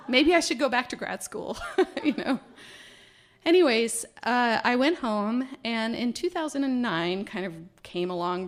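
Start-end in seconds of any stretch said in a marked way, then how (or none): 0.0-2.4 laughing through the words, pitched up and louder; laughing at her own idea. Real, not acted. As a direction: giggle while you suggest it. Joking about switching to space.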